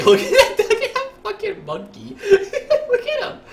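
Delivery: high, squealing giggles between words; real laughter. Real, not acted. Streamer cracking up at a monkey in the game, laughing high.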